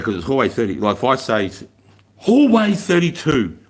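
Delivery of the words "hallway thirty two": drawn-out barked call, high and loud; yelled call. Retold or imitated. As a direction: call it down the hallway slowly. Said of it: "you yell out".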